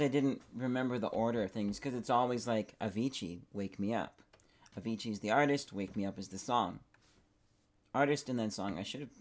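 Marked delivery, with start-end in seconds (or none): none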